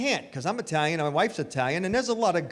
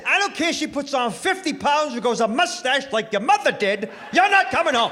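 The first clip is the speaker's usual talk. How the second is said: high mock shout, much louder; shouting dad; 8 semitones higher, 5 dB louder than usual. Dad telling groom he can't come home, hammed-up shout. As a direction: yell it like an old-school father. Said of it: "Then he says".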